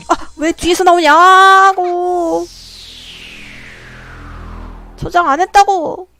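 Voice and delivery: high, loud, stretched-out screaming; panicked scream. Real, not acted. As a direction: shriek in real terror. Monster appears from behind.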